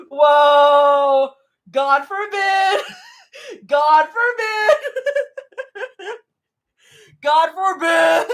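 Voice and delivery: high, drawn-out words through laughter; helpless laughing repeats; laughing hard. Real, not acted. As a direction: crack up while saying it. Cracking up over the line read.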